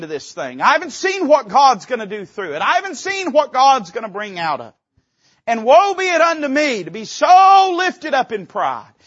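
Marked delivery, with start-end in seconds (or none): 5.5-8.9 raised exhortation. Real, not acted.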